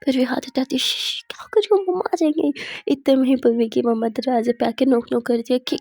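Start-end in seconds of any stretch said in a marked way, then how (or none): 0.8-2.5 urgent shushing. Acted. Shushes her before mother comes.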